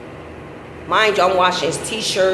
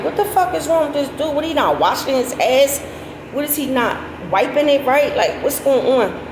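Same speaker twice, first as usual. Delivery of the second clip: disgusted disbelief; 6 semitones higher than usual. Retold or imitated. Disgusted retold inner reaction.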